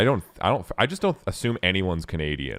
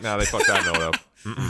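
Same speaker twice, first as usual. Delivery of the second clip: mock disapproval; 5 semitones higher, 5 dB louder than usual. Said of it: "where I'm just like".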